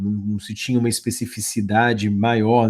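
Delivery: normal talking voice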